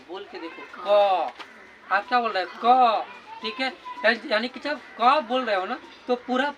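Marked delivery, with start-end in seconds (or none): 0.8-1.3 क said loud and held in the throat; letter demo. Shown as a demonstration. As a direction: push out क from the throat. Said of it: "बोल कर देखो ना". (कंठ से क का उच्चारण)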